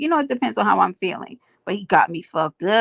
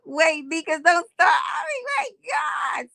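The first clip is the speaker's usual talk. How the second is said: excited shout; 8 semitones higher, slower than usual. Real, not acted. Greets viewer, erupts.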